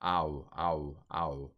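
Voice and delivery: ow said low and drawn as in tau; ow diphthong. Shown as a demonstration. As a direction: sound the diphthong ow. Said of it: "this diphthong is pronounced tau".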